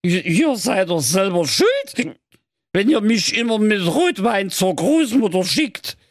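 high, stumbling tipsy protest; drunken slur. Acted. Codekäppchen blames the brothers for the wine, high drunken slur. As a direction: slur back defensively in a high voice. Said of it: "lallte das Codekäppchen".

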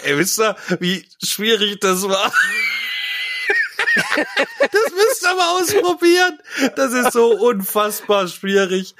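high and loud with laughter; excited, laughing. Real, not acted. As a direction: gush about how hard it was. Relieved after hard performance. (aufgeregt und lachend)

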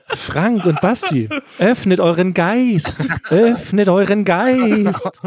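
slow, mystical forest wizard. Pretend. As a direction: intone it like a crazed hermit wizard. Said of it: "ich spreche ab jetzt wie so ein wirrer Zauberer".

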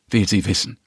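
usual speaking voice

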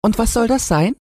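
high squeaky piglet question; very high-pitched pig character; curious little pig. A narrator's reading. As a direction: ask it in a tiny pig voice, rising at the end.

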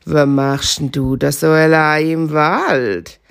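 low, slow, hushed fox voice; sly fox. Acted. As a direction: fox sneaking up on the hare.

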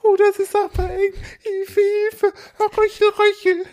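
very high strangled puppy cry; gasping for air. Pretend. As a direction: whimper for help, choking.

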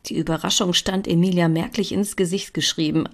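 ordinary delivery, nothing marked

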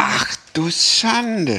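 high, slow "ach du Schande"; drawn-out shocked exclamation; stretched, dismayed gasp of sympathy. Real, not acted. Reaction to onion smell story.

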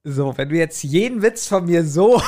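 laugh-shouted complaint through giggles; laughing protest. Real, not acted. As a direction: break up laughing while protesting.

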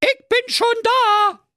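very high, drawn-out squeaky call. Acted. She-hedgehog calling from the kitchen to the hare, high and drawn out. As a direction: sing it out slowly from another room. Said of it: "rief aus der Küche".

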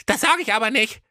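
high goofy prince voice; high cartoon prince refusing; cheeky prince refusing.

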